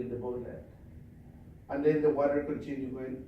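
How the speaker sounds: normal talking voice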